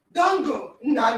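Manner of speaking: normal talking voice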